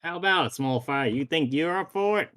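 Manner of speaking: bad Western accent. Cowboy challenging a small fry, bad Western accent. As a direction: dare them in a corny Western accent. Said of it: "my incredibly bad Western accent".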